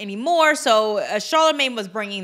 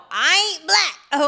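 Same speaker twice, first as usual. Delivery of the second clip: imitating [name]; 6 semitones higher, slower than usual. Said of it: "her comment of".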